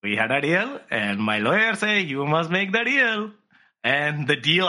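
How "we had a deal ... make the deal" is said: polite mock Indian accent, laughing through it; hammed-up Indian accent greeting, smug. Parag greeting Elon in a mock Indian accent. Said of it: "And Parag's like".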